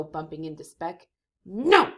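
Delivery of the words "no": loud defiant shout. Acted. Tornado Joe refusing, loud shout. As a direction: bark it out. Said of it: "says Joe".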